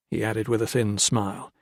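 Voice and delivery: ordinary delivery, nothing marked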